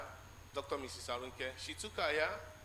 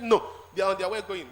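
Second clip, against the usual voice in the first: loud insistent no from auntie; 4 semitones higher, 12 dB louder than usual. Retold or imitated. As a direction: push the refusal out loud. Auntie insists on her ride.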